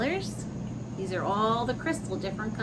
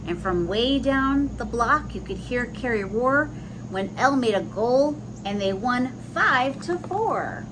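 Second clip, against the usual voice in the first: lilting, sing-song rhyming read-aloud; storybook voice with a gentle bounce; reading to children; 3 semitones higher than usual. A narrator's reading. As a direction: read the rhyme to little listeners. Reads rhyming picture book aloud.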